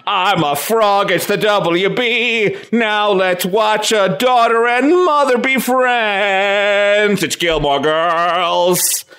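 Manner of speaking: slow, stretched-out cartoon frog voice; frog mascot pitchman. WB frog introducing Gilmore Girls, slow drawn-out cartoon mascot. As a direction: draw it out like a singing frog announcer. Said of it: "he's like".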